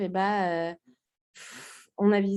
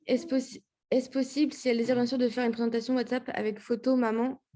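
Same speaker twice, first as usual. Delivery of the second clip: ordinary talk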